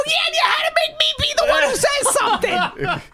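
sustained high shriek of blame; angry outburst. Retold or imitated. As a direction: scream the accusation at them.

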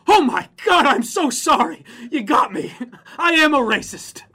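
high, loud, hammed-up sobbing confession; mock hysterical apology. Mocking people who apologize to critics, hammed-up wailing. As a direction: wail it like a melodramatic penitent.

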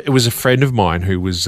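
ordinary talk

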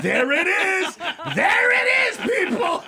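high, very loud proclamation; triumphant yell. Acted. Comic shows the crowd he found it, triumphant shouting. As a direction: announce it to the whole crowd, shouting.

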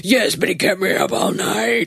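whiny, tearful complaining husband; grumpy husband impression. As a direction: whine it like a sleepless grump. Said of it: "That was a dead-on impression".

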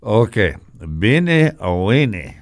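put-on slow, loud French chef accent. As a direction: drag out bene o bene like a French chef. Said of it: "he has this great French accent".

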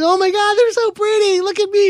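high, gushing over the flowers; gushing delight. Retold or imitated. Homeowner gushing to the process server, high and delighted. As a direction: squeal over the bouquet like a thrilled homeowner.

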